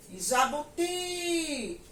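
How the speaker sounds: "jabuti" with a long, high, held TI; slow, high drawn-out TI ending "jabuti"; stress on the last syllable. Shown as a demonstration. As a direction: call out jabuti, stretching the final "ti". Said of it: "eu vou dizer javali".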